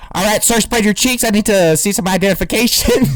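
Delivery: comic retold traffic-cop voice; mock cop. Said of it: "a cop could pull you over and be like".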